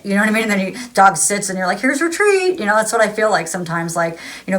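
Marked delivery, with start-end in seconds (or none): 1.8-2.6 much higher, sing-song treat voice; praising a dog. Retold or imitated. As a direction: coo at the good doggy. Rewarding the imagined dog.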